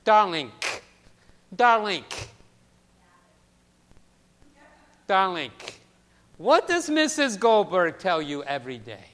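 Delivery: a hint of an elderly immigrant woman's lilt; Mrs. Goldberg's accent. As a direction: coax the child fondly in a mild old-world accent. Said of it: "that accent".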